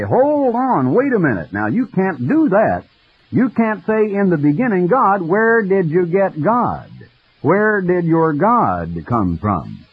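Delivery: high mock protest that drags out the last questions; mocking indignant objection. Scientists object to theologian.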